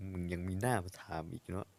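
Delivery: muttered aside. Acted.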